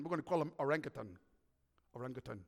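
quick low decision, the repeat fading to near silence; decide it fast, then murmur it again; God deciding, fast. Pretend. God names the funny-looking one, low quick decision.

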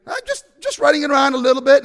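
lightly acted breezy brush-off, high and quick; mock-casual bully. Bully brushes off boy's plea.

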